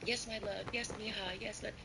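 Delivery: high, cooing mock sweetness; imitating Laura's cooing pet names; sugary pet-name voice. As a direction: lay the affection on thick. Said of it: "she always will call you a cute little pet name".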